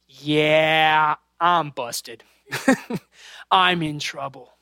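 low, drawn-out imitation of a caught kid; mock resignation. Lost son hearing dad's voice and knowing he's busted, mock resigned. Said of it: "that moment when he knew".

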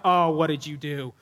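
dismayed low voice. Retold or imitated. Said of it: "I'm like".

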